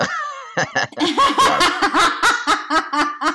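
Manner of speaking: loud high burst of laughter; big laugh. Real, not acted. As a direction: let out a long, loud laugh. Risa tras broma. (carcajada aguda y fuerte)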